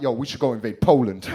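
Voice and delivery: loud, breezy laughing suggestion; casual villain. Retold or imitated. The other sign talking like a chummy fascist, loud and breezy. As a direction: toss it off like a mate's plan. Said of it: "This one's a bit more like".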